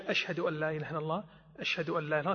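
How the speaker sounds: low voice. Shown as a demonstration. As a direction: murmur the shahada quietly. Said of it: "بزيادة الترجيع في الشهادتين". (بصوت منخفض خافت)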